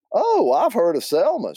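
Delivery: high-pitched Southern aunt; chirpy, high and loud reply; Aunt Hallie. Acted. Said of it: "do my best Aunt Hallie and Linda".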